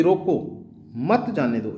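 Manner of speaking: slow "रोको", break, then "मत जाने दो" together; pause after रोको. Shown as a demonstration. Explaining that the child must not go, pause after "रोको" before "मत जाने दो". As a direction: separate "रोको" from a firmly grouped "मत जाने दो". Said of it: "दूसरे के अंदर क्या हुआ".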